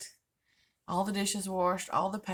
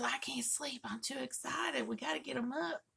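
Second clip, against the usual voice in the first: put-on childlike excitement; 6 semitones higher, 5 dB quieter than usual. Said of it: "He goes".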